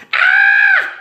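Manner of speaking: very high, loud shriek of alarm; cook's shriek. Acted. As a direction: shriek at the mouse. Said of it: "said Cook".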